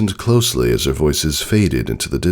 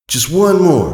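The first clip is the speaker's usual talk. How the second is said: put-on much higher young filly voice; Scootaloo's voice; 13 semitones higher, 5 dB louder than usual. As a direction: raise the voice high like a young pony.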